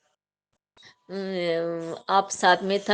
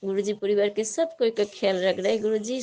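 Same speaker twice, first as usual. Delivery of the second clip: ordinary talk, nothing marked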